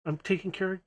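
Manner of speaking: high, softened exasperation; a little flustered. Acted. Kiara stuck minding the little bots, flustered. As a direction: sigh it out high, mildly overwhelmed. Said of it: "flustered a little bit".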